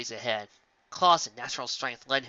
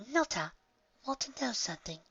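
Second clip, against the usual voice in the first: put-on little girl voice; 11 semitones higher, 5 dB quieter than usual. A narrator's reading. Young Melody voiced.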